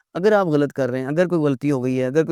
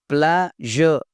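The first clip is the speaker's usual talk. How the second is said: usual speaking voice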